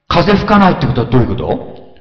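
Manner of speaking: normal talking voice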